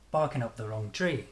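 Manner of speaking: "Barking up the wrong tree" peaking on "tree"; strong "Bar", reduced "up the", biggest stress on "tree"; strong-weak rhythm. Shown as a demonstration. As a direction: make "tree" the main beat of "Barking up the wrong tree". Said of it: "very strong".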